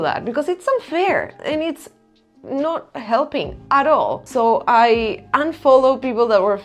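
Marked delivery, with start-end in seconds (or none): none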